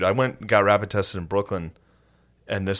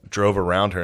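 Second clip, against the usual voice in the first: normal talking voice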